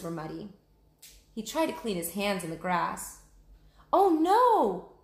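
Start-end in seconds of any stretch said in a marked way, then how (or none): 3.9-5.0 much higher, louder alarmed cry; shouted. Acted. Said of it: "shouted Annie".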